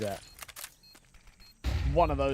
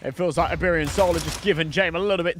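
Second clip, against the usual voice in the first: louder live match call; rising energy on a headshot; excited play-by-play; 6 semitones higher, 10 dB louder than usual. Acted. Big play in the round.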